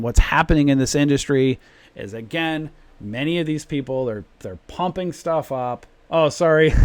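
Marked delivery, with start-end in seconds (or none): 6.1-6.7 loud, laughing apology; sheepish loud apology. Real, not acted. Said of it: "I'm probably yelling".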